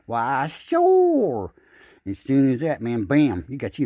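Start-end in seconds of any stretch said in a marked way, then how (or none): 0.1-1.5 high, loud put-on fish voice; goofy talking fish. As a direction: answer like a cheerful fish. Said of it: "he gonna shout back and say".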